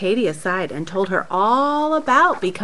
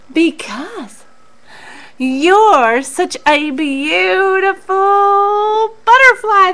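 rabbits flattering; 7 semitones higher, 3 dB louder, slower than usual. Acted.

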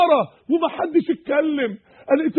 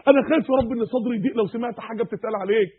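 ordinary talk, nothing marked

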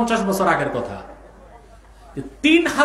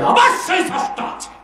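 proclaiming; 12 semitones higher than usual. Acted. Climax of quoted argument.

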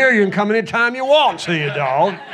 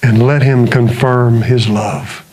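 slow, intense sermon building to a hushed close; earnest preacher lowering to a hush; preaching; 7 semitones lower, slower than usual. Acted. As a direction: preach it, then drop to a whisper. Preaching to congregation.

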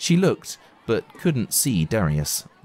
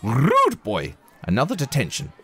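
shouting teacher; 7 semitones higher than usual. Acted. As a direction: scold him sharply. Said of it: "shouted Miss Honey".

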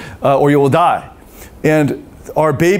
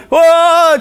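high, loud toddler fake wail; a put-on bawl to summon mommy; fake cry; 13 semitones higher, 5 dB louder than usual. Two-year-old testing mommy, high loud fake wail. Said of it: "trying to fake cry".

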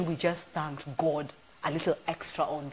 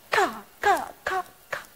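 mock heel clicks, pitched high; clacking heels imitation; 11 semitones higher than usual. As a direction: click out the pumps on the church floor.

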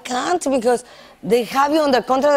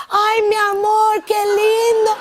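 high, sweet Spanish endearment, raised; cooing at duck; 10 semitones higher, 3 dB louder than usual. Retold or imitated. Woman cooing lovingly at the duck in her house. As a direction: coo over the pretty duck, lightly voiced.

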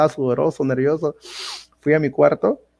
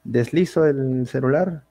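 ordinary talk

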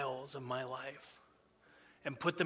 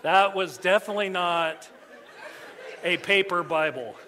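laughing through the words, louder; 8 dB louder than usual. Real, not acted. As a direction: chuckle at the phone mishap. Laughing after phone read aloud.

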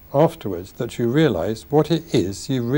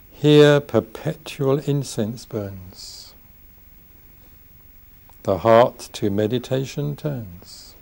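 slow, measured poetry reading with long pauses; unhurried verse, each phrase set apart by silence; poem recital; slower than usual. Acted. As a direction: recite it solemnly, lingering on each line. Reads his own poem aloud.

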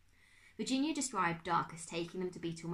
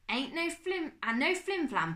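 high, loud blustering uncle; emphatic no-nonsense demand; gruff Uncle Max; 10 semitones higher, 6 dB louder than usual. Acted. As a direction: bluster it out like a fussy old uncle.

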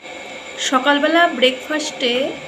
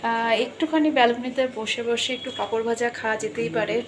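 usual speaking voice

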